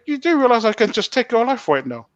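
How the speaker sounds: high-pitched, forceful retold threat; menacing challenge. Prisoner threatening the pastor alone in a room, confrontational. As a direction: act out a heated threat without shouting.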